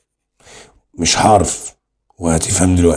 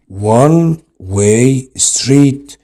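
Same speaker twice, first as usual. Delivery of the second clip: one way street spoken very slowly, word by word; slow dictation; 5 semitones higher, much slower than usual. Shown as a demonstration. Teacher to students, dictating the example very slowly. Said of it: "شوف one بتنطق ايه؟".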